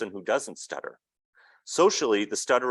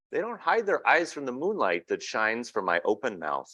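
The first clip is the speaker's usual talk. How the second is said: picture book reading; 5 semitones higher than usual. A narrator's reading. Reading a picture book aloud to an audience, storybook narration. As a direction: read the child's words gently to the room.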